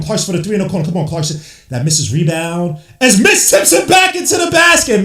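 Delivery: excited call leaping into shouts at each big play; fired-up basketball commentary; live play-by-play. Real, not acted. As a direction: call the action fast-moving and hyped.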